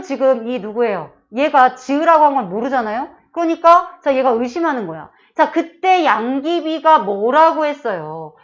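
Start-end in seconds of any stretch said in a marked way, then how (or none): none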